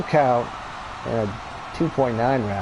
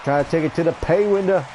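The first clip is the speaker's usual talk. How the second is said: excited call; 6 semitones higher, 4 dB louder than usual. Real, not acted. Big move, near finish.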